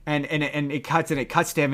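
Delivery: usual speaking voice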